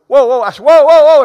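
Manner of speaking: loud, high retold call to stop someone. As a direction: holler after the lady walking away. Calling cashier back.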